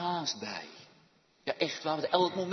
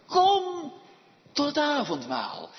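loud call opening high, then slowing; calling invitation; 12 semitones higher, 9 dB louder, much slower than usual. Acted. Preacher voices the invitation to the supper, calling out. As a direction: call the guests in from afar. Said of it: "Die werden geroepen, genodigd".

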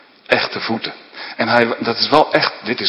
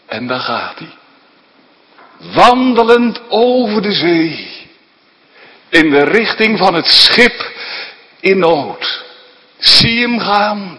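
dramatic sermon storytelling; 5 semitones higher, 4 dB louder than usual. Acted. Preacher telling children of Jesus walking on the sea, rapt and vivid. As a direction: paint the scene for the listening children.

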